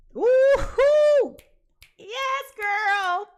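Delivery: loud, high whooping cheer; cheering woo-hoo. Acted. Reader cheering person A's fresh start, whooping loudly. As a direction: whoop it up for her like a hype friend.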